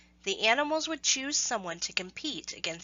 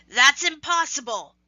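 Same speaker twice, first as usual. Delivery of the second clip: loud, higher outraged cry; screaming tiger; 6 semitones higher, 8 dB louder than usual. Acted. Losing tiger to the animals, a loud disbelieving scream. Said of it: "the tiger screamed".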